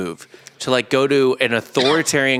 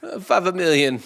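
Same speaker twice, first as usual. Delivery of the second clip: put-on hammy Italian accent, higher; 6 semitones higher than usual. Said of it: "so I'll just do Italian".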